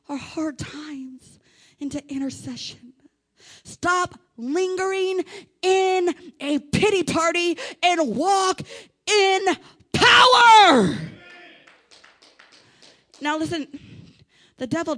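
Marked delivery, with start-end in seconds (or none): 3.6-11.0 slow, spaced words exploding into a shout; measured exhortation rising to full volume; preaching build. Real, not acted. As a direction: punch each phrase, roar the last word.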